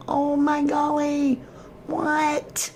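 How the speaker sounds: high-pitched gasp of disbelief; rising squeak of amazement; surprised. Real, not acted. Streamer stunned by what she just read, high squeak. Reacting to found clue.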